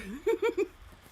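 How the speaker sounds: bright burst of laughter; loud high laugh. Real, not acted. Laughs at long break.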